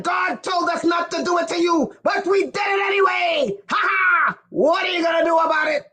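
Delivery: imitating a gloating speaker; gloating taunt. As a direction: crow it like a bragging challenge. Acting out Delaney's taunt on the microphone.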